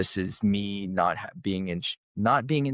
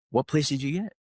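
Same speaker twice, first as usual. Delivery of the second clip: coach's voice pitched higher; retelling a track coach's questions in a raised voice; imitating coach; 6 semitones higher, faster than usual. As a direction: quiz him like a stern coach. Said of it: "And he goes".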